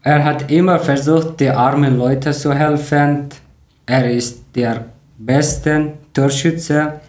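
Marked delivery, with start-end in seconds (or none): none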